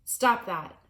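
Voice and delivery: loud bellowed order; father bellows at his son to stop; bellowing. Acted. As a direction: bellow it to stop him cold. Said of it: "bellowed Mister Little".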